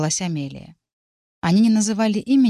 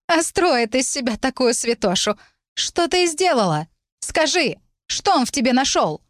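higher, bitter jealous rant; jealous tirade; 7 semitones higher than usual. Acted. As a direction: spit out a jealous outburst.

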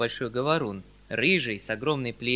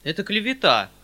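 high, loud protest; flustered cry; 6 semitones higher, 7 dB louder than usual. A narrator's reading. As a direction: exclaim in embarrassment. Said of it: "вскричал дядя, покраснев и ужасно сконфузившись". (смущённый протестующий возглас)

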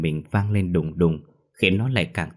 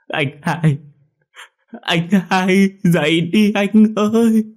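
high, loud, slow tearful wail; begging his dead brother to wake up; sobbing plea; 12 semitones higher, 6 dB louder, slower than usual. A narrator's reading. Boy holds brother's cold hand, wailing plea. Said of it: "mà khóc lóc".

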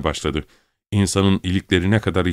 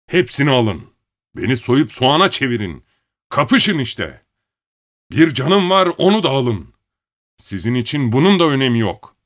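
raised, higher-pitched angry outburst; furious shouting; 6 semitones higher, 3 dB louder than usual. A narrator's reading. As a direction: shout it out as an enraged father. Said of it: "Avazı çıktığı kadar bağırarak".

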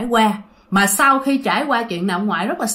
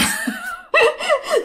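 high burst of laughter. Real, not acted. Teller laughs at own story.

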